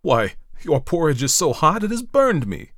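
high, loud outraged complaint; loud, pained indignation; screamed. A narrator's reading. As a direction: cry out as if just burned. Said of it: "screamed the man".

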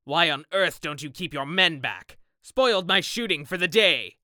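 loud, indignant complaint; irate sportsman's outburst; angry scolding. Acted. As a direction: berate the keeper, building in volume and pitch. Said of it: "answered Sir Geoffrey angrily".

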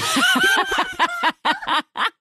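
big high laugh; real, high-pitched cackling laugh; burst of laughter. Real, not acted. Real reaction to joking threat.

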